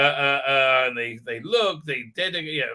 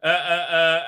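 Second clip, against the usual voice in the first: ordinary talk, nothing marked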